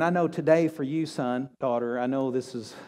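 soft, low, hushed comfort; quiet promise that it's handled; tender reassurance. Acted. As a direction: speak gently to a hurting child. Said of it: "And God's saying".

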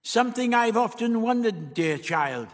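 high-voiced dry tease; dry reply. A narrator's reading. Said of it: "he answered dryly".

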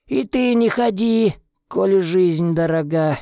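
put-on high, wheezy old villager warning; a shuffling old man scolding, pitched high; breathless old person. Old man warns Tim away.

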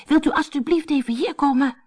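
high-pitched pleading call; insistent call. A narrator's reading. Anneke asks mother to come over, high insistent call. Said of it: "riep Anneke nu wat harder".

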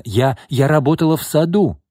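high, heated self-defence; spiteful cry. A narrator's reading. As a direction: protest angrily, stumbling on the first word. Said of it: "злобно крикнула Лина".

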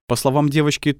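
plain reading voice

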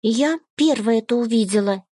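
lightly voiced bold little girl; insistent small girl. A narrator's reading. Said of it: "заявила она".

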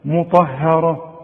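slow "مطهره", dark ta, thin ha, dark ra; fronted "hae" inside "مطهره"; correct light ha. Shown as a demonstration. Teacher demonstrates the correction, slow light ha. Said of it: "لكن اله هي المرققه".

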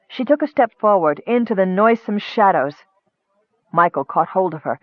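plain reading voice

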